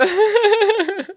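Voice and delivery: short high burst of laughter. Real, not acted. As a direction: let a high laugh pop out.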